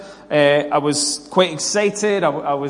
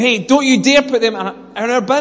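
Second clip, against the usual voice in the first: higher-pitched mock telling-off; mildly raised, acted-out objection; imitated shouting; 5 semitones higher than usual. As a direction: scold like an indignant neighbour. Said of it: "gentleman shouting at me, saying".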